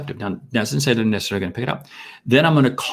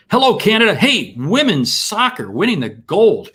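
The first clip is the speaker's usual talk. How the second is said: excited shout-out; 9 semitones higher, 5 dB louder than usual. Real, not acted. Greeting live listeners from abroad.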